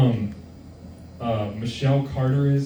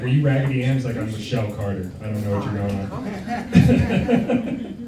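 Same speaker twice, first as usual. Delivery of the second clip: playing Michelle Carter; 2 semitones lower than usual. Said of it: "be in a dress and be like".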